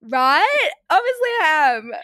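high, loud mock-vindicated exclamation; emphatic agreement. Friend siding with you in a retold fight, emphatic agreement. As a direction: play up being proved right, pitched high and loud.

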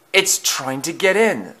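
high, anxious realisation; frightened thought. A narrator's reading. Thomas fears it is breaking in, high frightened thought. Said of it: "thought Thomas".

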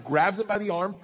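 usual speaking voice

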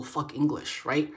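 ordinary talk, nothing marked

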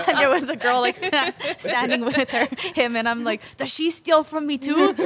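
high, loud, laughing disbelief. Real, not acted. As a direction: tell it cracking up. Shocked amusement at friend.

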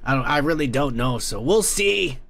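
ordinary talk

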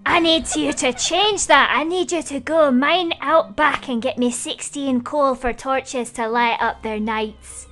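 light Irish-style lilt, leisurely asking a favour; pumpkin accent. Pretend. Pumpkin villager asks the players for coal in a light Irish-style accent. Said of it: "gotta have the true accent of this guy".

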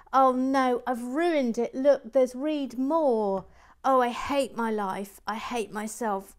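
high, loud lament at own blunder; loud anguished wail. Real, not acted. Host who has ruined the message reading, anguished wailing.

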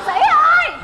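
calling out. Acted. Calling brother-in-law loudly.

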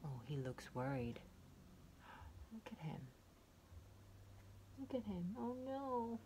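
plain reading voice

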